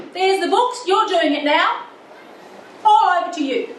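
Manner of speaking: imitating a cross wife dumping the job on him; wife snaps the books over to her husband, cross and loud. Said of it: "And she said it so crossly".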